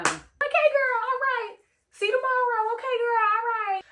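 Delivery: light mock-sweet goodbye; breezy brush-off. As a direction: humor her on the way out the door. Brushing off friend while leaving.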